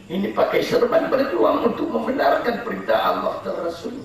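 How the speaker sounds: high, laughing scorn; mocking sermon. Real, not acted. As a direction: ridicule the timid men. Fiery preaching, mocking.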